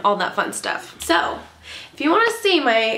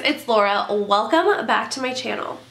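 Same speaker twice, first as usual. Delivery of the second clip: ordinary talk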